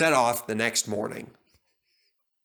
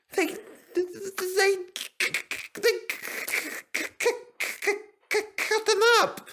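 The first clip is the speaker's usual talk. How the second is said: near sobbing. A narrator's reading. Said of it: "Percy nearly sobbed".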